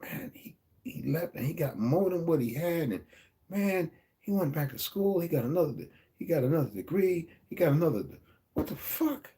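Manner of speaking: ungläubig nachgeäffte Leute, höher und leiser, am Ende ein Aufschrei; mocking gossip voice. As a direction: spiel die staunenden Nachbarn, die es nicht fassen können. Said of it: "people will sit there going".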